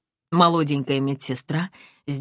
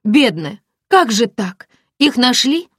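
put-on higher, louder girlfriend in dismay; sympathetic friend; 9 semitones higher, 9 dB louder, slower than usual. Friend reacts to the broken arm, high and loud. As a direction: fuss over her friend.

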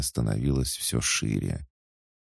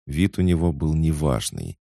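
usual speaking voice

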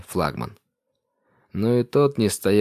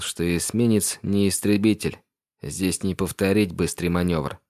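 usual speaking voice

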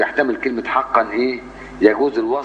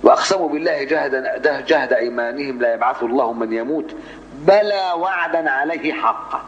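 Quran recitation; 3 semitones higher than usual. Shown as a demonstration. Teacher reciting the Nahl verse in measured recitation. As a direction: chant the verse, stretch the close.

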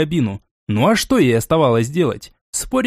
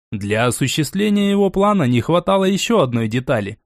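plain reading voice

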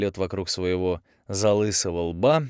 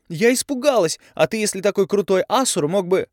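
angry yell; 11 semitones higher, 7 dB louder than usual. A narrator's reading. Tavi yelling at Andrey.

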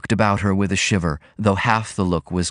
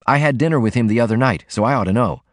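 ordinary delivery, nothing marked

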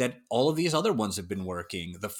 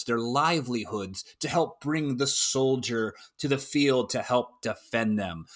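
ordinary talk

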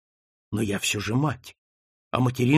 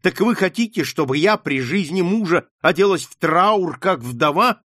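higher, louder passionate tirade; unstoppable outburst; 6 semitones higher, 4 dB louder than usual. Acted. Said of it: "не умолкала Маргарита".

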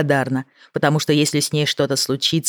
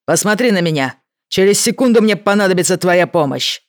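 loud urgent commands; 4 semitones higher, 4 dB louder than usual. A narrator's reading. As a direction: shout it over the engine. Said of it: "громко сказал Джейк".

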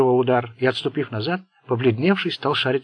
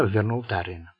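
ordinary delivery, nothing marked

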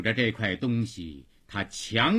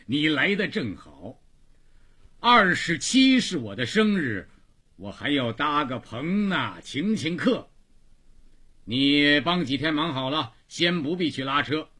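raised, higher-pitched voice of an employer; bossy rickshaw-yard master; 5 semitones higher, 5 dB louder than usual. Acted. Boss recruits Xiangzi for his birthday, hearty raised voice. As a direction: announce plans and hand out work.

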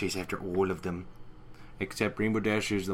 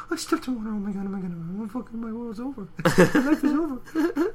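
much higher, hammed-up despair; dramatic Rarity voice; 13 semitones higher than usual. Rarity melting down over wet hooves, high mock wail. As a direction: wail like a drama queen in a put-on voice. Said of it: "She's like".

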